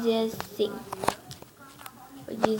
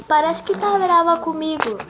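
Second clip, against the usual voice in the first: higher, louder acted reproach; acted worried complaint; 5 semitones higher, 8 dB louder than usual. Friend asks if she is angry, acted worried complaint. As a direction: voice the friend's worry, loud and raised.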